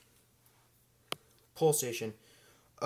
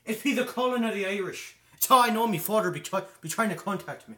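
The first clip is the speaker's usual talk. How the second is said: put-on Irish brogue, pitched higher; higher, louder hammed-up Irish lilt; mock Irish accent; 5 semitones higher, 6 dB louder, faster than usual. Jokey Irish voice about father calling.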